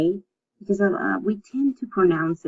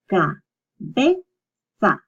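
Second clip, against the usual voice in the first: "cabeza" very slowly as ca, be, za; long gaps between each syllable of "cabeza"; 2 semitones higher, much slower than usual. Shown as a demonstration. Teacher sounding out a word for learners, "cabeza" in separate syllables. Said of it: "you separate the two".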